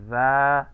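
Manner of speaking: da, loud and stressed, the feminine sound; feminine ending da. Shown as a demonstration. Teacher demonstrating the feminine ending, a loud da. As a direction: sound out da sharply for the feminine form. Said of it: "si es femenino".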